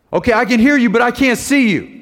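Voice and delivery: high, loud worried call downward; anxious shout. Retold or imitated. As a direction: call down scared, can't see him. Nephew still panicking in tree.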